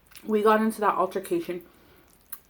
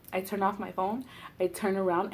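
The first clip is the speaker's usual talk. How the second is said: ordinary delivery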